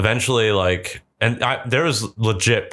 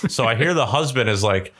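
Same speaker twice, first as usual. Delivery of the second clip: usual speaking voice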